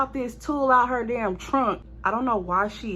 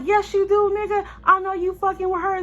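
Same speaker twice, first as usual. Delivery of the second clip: high heated retort; 10 semitones higher, 3 dB louder than usual. Retold or imitated. Arguing with boyfriend outside.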